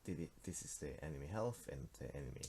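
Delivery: ordinary delivery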